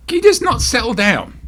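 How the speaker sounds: fed-up pet scold; scolding the cat. Real, not acted. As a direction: tell the pet off.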